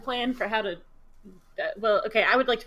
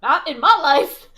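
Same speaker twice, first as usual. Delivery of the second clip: huffy retort; 9 dB louder, slower than usual. Acted. Nyla storming off.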